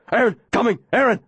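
high yelped name; dog yelping a name. Acted. Dog racing past the children, yelping. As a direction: yelp it like an excited dog.

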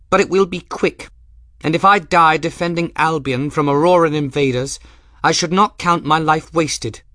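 high, loud imperious noblewoman; furious commanding lady's voice; grand hollywood dame. Acted. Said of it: "copy her mother's furious, imperious voice of command".

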